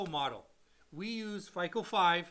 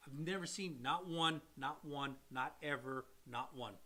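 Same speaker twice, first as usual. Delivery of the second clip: not one said quieter and quieter; fading emphasis; 6 semitones lower, 7 dB quieter than usual. Real, not acted. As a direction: stress NOT, each repeat softer and lower. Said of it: "repeated emphatic denial, fading".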